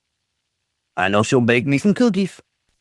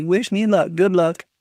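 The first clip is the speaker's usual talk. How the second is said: ordinary delivery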